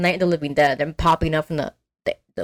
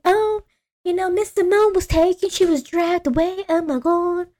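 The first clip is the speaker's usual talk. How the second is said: high, thin-sounding feigned alarm; put-on high damsel-in-distress voice; mock-distressed [name]; 12 semitones higher than usual. [name] reporting Simone dragged away, mock distress. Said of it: "she was just like".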